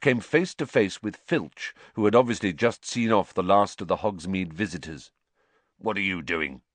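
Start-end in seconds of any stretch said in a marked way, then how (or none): 5.8-6.6 surly, distrustful challenge; suspicious snarl. A narrator's reading. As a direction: question them with narrow suspicion. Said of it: "Filch snarled suspiciously".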